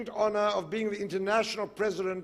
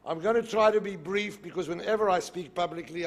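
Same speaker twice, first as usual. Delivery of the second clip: ordinary delivery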